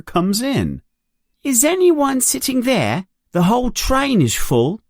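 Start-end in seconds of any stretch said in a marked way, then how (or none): 1.4-4.8 put-on higher, boyish voice for Ron; a boy asking politely for a seat; young boy Ron. A narrator's reading. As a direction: play an eager young boy. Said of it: "Ron asks about seat".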